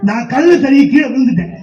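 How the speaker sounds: usual speaking voice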